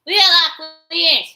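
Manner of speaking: high, loud mocking yelp; mock high shout. Voices mocking her, mimicked as a high, loud yelp. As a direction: squeal it out like a taunt.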